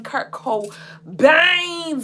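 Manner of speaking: shouted lyric. Pretend. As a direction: belt the line out.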